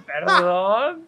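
a cheeky apology through a laugh; sheepish sorry. Retold or imitated. Sorry reply to mom.

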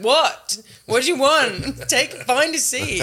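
loud, high mock-rude waiter barking orders; imitating a brusque Texas waiter; rude American waiter. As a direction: play the deliberately rude server, laughing. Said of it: "they just come in and they go".

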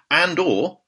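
normal talking voice

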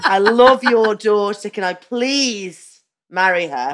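a hammed-up nervous plea; earnest suitor. Boyfriend asking the dad for his daughter's hand, earnest.

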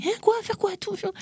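high, startled squeak of disbelief; mock shocked mother, high and thin; mother's shocked reply. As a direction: play the stunned mother, pitch shooting up.

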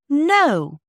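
loud, high indignant denial. A narrator's reading. Bobby indignantly answering Mother's question about forgetting Father, indignant. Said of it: "said Bobby indignantly".

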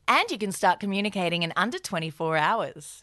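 ad read. Acted. BetterHelp ad read by both.

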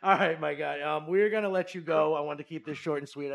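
loud, high upbeat wrap-up; upbeat sign-off. Real, not acted. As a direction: send him off with energy. Host wrapping up loudly.

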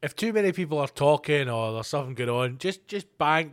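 ordinary talk

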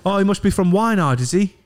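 high, mocking Teesside heckler; mock sneering local. Crowd member mocking the comic, lightly voiced. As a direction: sneer at the outsider's mistake. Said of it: "They're all thinking".

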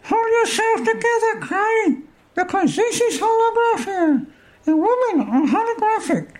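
high, squeaky little man. Acted. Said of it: "I should have done a different voice for Bob".

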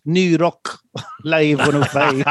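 drawn-out Scottish radio station ID; Scottish accent. Shown as a demonstration. Host imitating a radio station ID, Scottish accent. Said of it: "I could do one thing in Scottish".

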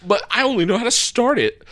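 a lightly voiced anime protagonist; earnest determined anime hero. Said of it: "can you do the anime main character voice".

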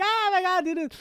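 high squeaky cartoon voice. Excited kids' cartoon quote.

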